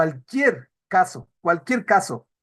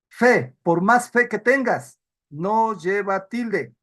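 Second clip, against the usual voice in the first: slow, deliberate; slower than usual. Shown as a demonstration. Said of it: "slow emphatic example".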